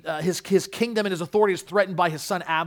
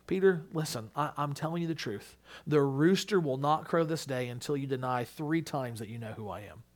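soft, lowered, grave voice; sober warning; 4 semitones lower, 7 dB quieter than usual. Acted. Jesus warning Peter of denial, sober and quiet. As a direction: tell him the hard truth quietly.

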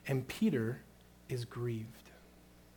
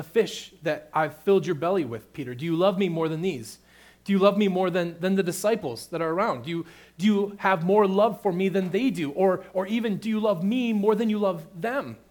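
louder and higher, pressing the question harder each time; pressing, rising plea; 5 semitones higher, 9 dB louder than usual. Retold or imitated. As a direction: push the question, building intensity. Preacher voicing Jesus' pressing question.

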